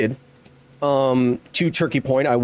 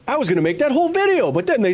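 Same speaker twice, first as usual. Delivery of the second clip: fast, high-pitched complaint; exasperated outburst; 8 semitones higher, faster than usual. Real, not acted. Streamer lamenting the video that got cancelled, exasperated. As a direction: blurt it out high and quick.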